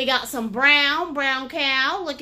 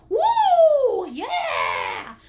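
excited inner voice; 7 semitones higher, 3 dB louder than usual. Acted. The creative urge bursts out to the painter, excited. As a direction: cheer like a sudden burst of inspiration. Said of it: "that creative stink bug comes up and it says".

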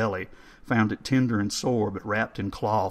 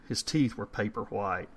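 ordinary delivery, nothing marked

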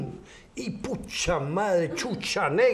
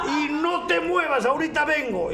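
excited command; 10 semitones higher, 3 dB louder than usual. Acted. Wife voiced again.

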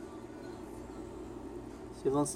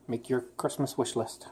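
usual speaking voice

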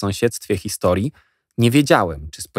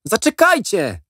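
calling out; 13 semitones higher than usual. A narrator's reading. Jack calls after boys.

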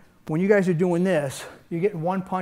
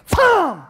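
high, loud thump for the strike; striking demo sound effect; shouted hit sound; 11 semitones higher, 7 dB louder than usual. Shown as a demonstration. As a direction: punch the sound out.

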